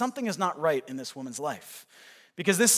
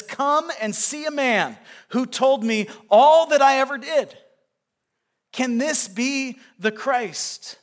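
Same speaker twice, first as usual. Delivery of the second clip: higher, louder, slow and wondering; excited announcement; 8 semitones higher, 7 dB louder, slower than usual. Acted. Woman running back to the village calls out in excited wonder. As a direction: call out to the whole village in awe.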